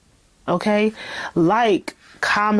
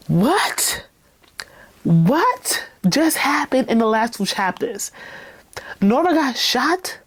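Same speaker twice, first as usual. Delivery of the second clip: incredulous questions swooping up at the end; pause, then marvel at the twist; astonished, spaced-out questioning; stunned disbelief; 4 semitones higher, slower than usual. Real, not acted.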